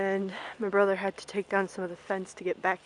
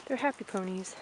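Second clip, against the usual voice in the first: normal talking voice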